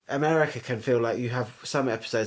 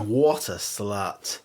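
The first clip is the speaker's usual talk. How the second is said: drawn out slow and flat; slow catchphrase; 5 semitones lower, much slower than usual. Shown as a demonstration. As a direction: spell it out like a sound button. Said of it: "I need a button saying".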